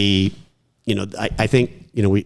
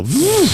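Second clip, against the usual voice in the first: a high, louder engine noise; mock engine vroom; 5 dB louder than usual. Brother needling him after a bad shot, mock engine vroom. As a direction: imitate wheels flying off a car. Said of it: "he'd go".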